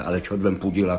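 ordinary delivery

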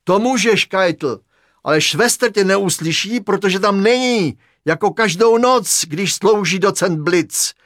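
ordinary delivery

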